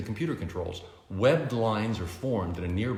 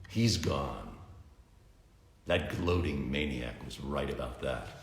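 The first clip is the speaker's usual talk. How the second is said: lower, softer brooding voice; grim Bruce; 6 semitones lower, 4 dB quieter than usual. A narrator's reading. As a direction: let the loss weigh on every word. Bruce grieves missing Superman.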